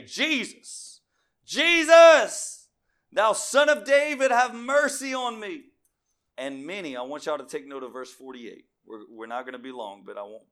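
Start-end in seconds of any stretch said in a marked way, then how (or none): 0.0-5.6 high, full-voiced desperate shout; beggar's loud plea over a crowd; crying out. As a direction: call out for mercy at the top of your lungs. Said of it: "he began to cry out".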